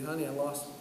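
low soft confession of dread; quiet dread. Retold or imitated. Father rehearsing telling his wife, soft and low. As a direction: say it like you can't bear to. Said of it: "How do I go home and say".

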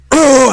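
loud, high effort groan; straining grunt. Retold or imitated. Firefighter lifting the door off by hand, straining groan. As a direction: groan as if heaving something heavy.

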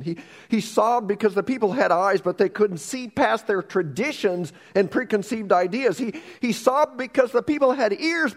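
impassioned preacher; preaching at full force. Real, not acted. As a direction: press the point to the crowd. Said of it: "preaching climax".

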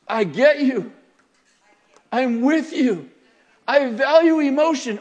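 loud, high, emphatic declarations; preacher voicing heartfelt solidarity loudly; impassioned empathy. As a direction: declare support with full force, pausing between phrases. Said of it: "he was saying to Mary".

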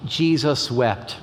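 solemn reading. Real, not acted. Reading shortest Bible verse.

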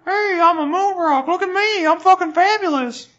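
very high, put-on cartoon voice; high squeaky fabulous rock voice; talking moon rock. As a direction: play a vain rock showing itself off.

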